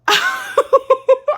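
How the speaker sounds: high, loud burst of laughter; loud cackling laugh; real laugh. Real, not acted. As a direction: crack up at your own story. Laughs at her own story.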